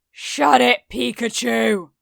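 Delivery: loud angry snap. Acted. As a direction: snap it back furiously. Kacchan snaps back.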